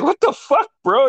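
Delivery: high-pitched mock incredulity; retold disbelief. Helping up his crashed friend, high retold disbelief. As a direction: pitch it up, acting out the shock. Retells reaction after bike crash.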